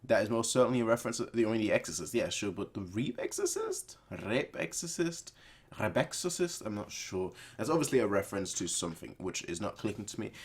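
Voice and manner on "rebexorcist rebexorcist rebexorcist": puzzled. Real, not acted. Puzzled repeats of odd title.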